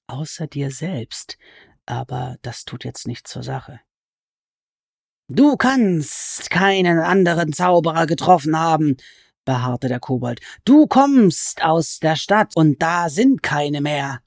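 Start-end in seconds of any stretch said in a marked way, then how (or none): 0.1-3.8 much softer, half to herself; quiet aside. Acted. Alice adding a quiet aside about herself, much softer. 5.3-9.0 sly goblin voice. Acted. Goblin crouched over Alice, complaining she is a sorceress, in a high sly voice. 10.6-14.2 sly goblin voice. Acted. Goblin insists.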